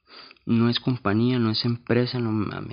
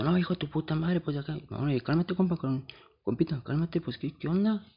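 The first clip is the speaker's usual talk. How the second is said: higher, softer placating voice; calming an angry guy down, pal; mock soothing; 5 semitones higher, 3 dB quieter than usual. Calming an angry guy who insults him, soft and placating.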